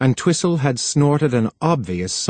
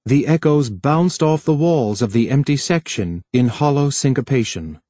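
ordinary delivery

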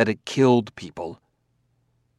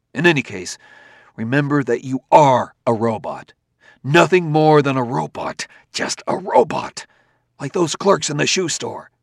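rising angry scorn, louder; 5 semitones higher, 4 dB louder than usual. Acted. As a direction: spit out the word robot with contempt. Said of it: "red with anger".

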